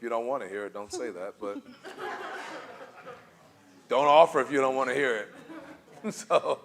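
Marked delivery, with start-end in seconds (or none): 1.7-3.2 burst of laughter. Real, not acted. Laughing at her own bluntness. 3.9-6.1 laughing. Real, not acted. Laughs while scolding hypothetical person.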